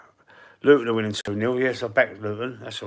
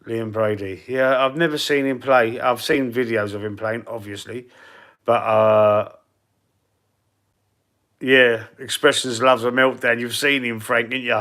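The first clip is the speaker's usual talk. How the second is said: ordinary talk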